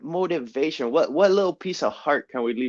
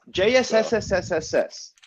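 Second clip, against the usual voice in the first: hissing S on and on like a snake; 8 semitones higher than usual. Acted. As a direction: stretch every S into a hiss. Repeating drawn-out hiss name.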